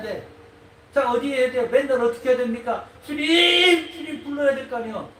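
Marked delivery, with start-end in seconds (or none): 3.1-4.2 high, loud call; calling on the Lord. Acted. Believer calls to the Lord in prayer, high and loud. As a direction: cry out to the Lord.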